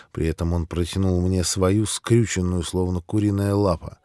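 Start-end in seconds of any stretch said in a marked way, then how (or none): none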